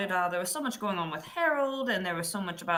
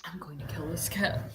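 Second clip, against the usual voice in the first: menacing whisper; hushed, murderous whisper; 5 dB quieter than usual. Acted. Player stalking the tiger in-game.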